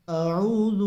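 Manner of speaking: اعوذ with hamza first, then a clear throaty ain; clear ain sound. Shown as a demonstration. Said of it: "عین کی آواز نکالنی ہے".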